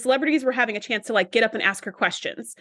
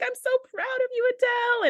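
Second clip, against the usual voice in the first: proud teacher; 14 semitones higher than usual. Retold or imitated. The teacher to [name] on stage, high and moved. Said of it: "And she's like".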